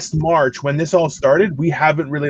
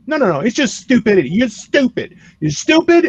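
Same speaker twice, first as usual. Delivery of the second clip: normal talking voice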